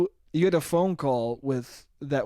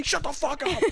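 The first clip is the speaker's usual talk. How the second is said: higher, hammed-up snapping parent; mock angry mother; 11 semitones higher, 3 dB quieter than usual. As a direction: play the furious mom.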